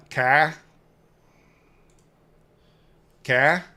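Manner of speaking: loud questioning Maine accent; tentative Maine-accent test word, punched out; trying a Maine accent. Shown as a demonstration. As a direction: drop the R like a Mainer. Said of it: "how can I do a Maine accent?".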